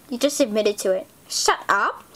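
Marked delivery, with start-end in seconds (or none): none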